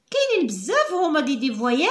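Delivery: ordinary delivery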